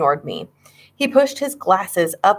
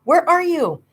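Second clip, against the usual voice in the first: high, worried call; calling into the empty house; worried shout; 11 semitones higher than usual. A narrator's reading. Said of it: "I shouted".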